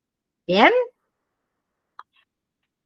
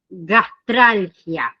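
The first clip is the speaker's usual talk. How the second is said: slow clear term; 3 semitones lower, much slower than usual. Shown as a demonstration. Teacher presents medical term.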